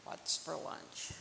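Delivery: soft, near-whispered title; hushed repeat. A narrator's reading. Reader repeating the story's title, hushed. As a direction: drop the title to a hush.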